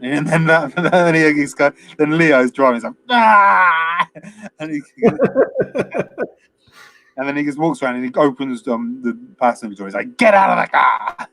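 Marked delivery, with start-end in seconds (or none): 3.1-4.1 loud, high imitated scream; mock yell of fury; hammed-up scream. Imitating Leo's scream while driving. 10.2-11.3 high mock yell, words running together; hammed-up, high bellow; garbled furious yell. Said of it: "he sort of doesn't even say it".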